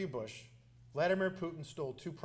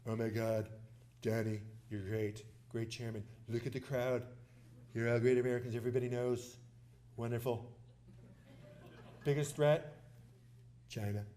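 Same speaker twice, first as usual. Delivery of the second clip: low-pitched presidential impression; Donald Trump; 6 semitones lower, 4 dB quieter than usual. Imagined president greeting a chairman and crowd, impersonated. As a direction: play the former president praising the room in a low voice. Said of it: "I think he would say, if he walked in there".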